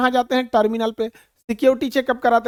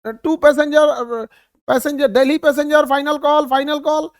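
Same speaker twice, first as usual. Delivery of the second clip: loud, higher boarding call into the mike; mic announcement; 4 semitones higher, 5 dB louder than usual. Retold or imitated. Airport staff on the mike calling Delhi passengers, loud. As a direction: call the flight over the mike. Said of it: "mic लेके कहेगा".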